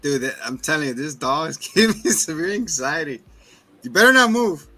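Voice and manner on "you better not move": high, loud warning to the dog; sharp warning. Real, not acted. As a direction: shout a real warning at a dog.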